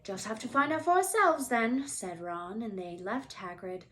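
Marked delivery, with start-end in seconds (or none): 0.0-1.9 Ron defiant. A narrator's reading. Ron voiced in story.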